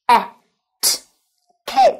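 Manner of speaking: a, t, then cat with a high pitched lift, each sound split apart. Shown as a demonstration. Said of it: "được phiên âm là".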